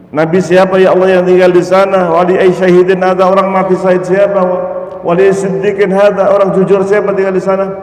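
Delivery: amazed questioning. Acted. Amazed man asking God.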